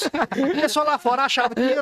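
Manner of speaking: normal talking voice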